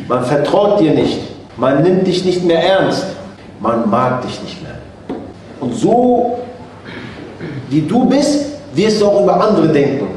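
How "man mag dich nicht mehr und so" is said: usual speaking voice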